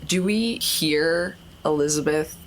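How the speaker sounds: usual speaking voice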